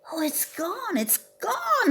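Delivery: high, tearful wail; crying out in distress. A narrator's reading. Bobcat finds the wheelbarrow gone, high and tearful. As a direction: cry it out as if the present is lost. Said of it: "he cried".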